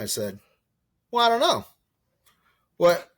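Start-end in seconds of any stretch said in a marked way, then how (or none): 1.1-3.1 much higher, louder mock shrug; hesitant younger self. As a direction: play the unsure kid caught off guard, way up high. Own past self quoted, hesitant.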